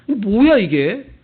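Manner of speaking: loud, indignant what is this; exasperated outburst. Acted. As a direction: snap it out in disbelief. Judas voiced, exasperated. (억울한 듯 큰 소리로)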